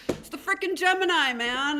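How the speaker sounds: high, loud excited cry of "Gemini"; excited outburst. Real, not acted. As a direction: burst out on "Gemini", pitch way up. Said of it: "it's the excitement".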